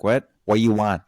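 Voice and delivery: mock-exasperated Filipino grandma; impatient Filipino grandma. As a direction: imitate a grandma's curt demand in mock accent. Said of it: "She's gonna say it like that".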